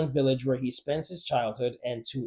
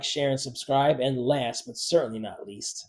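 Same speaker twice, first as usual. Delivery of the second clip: ordinary talk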